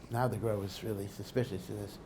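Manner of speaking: ordinary talk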